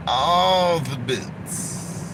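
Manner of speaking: very slow "all the boots" leaning on "all"; a slow, heavy punchline refrain; drawn-out refrain. Acted. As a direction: drag every word of "all the boots". Comic refrain.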